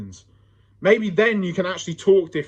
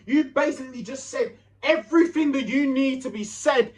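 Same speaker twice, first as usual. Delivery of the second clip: ordinary delivery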